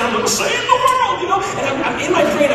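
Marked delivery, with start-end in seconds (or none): 0.0-1.2 high, grand hero voice; hammed-up superhero; heroic fantasy. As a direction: announce it like a superhero. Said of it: "I'm thinking".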